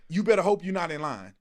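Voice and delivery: normal talking voice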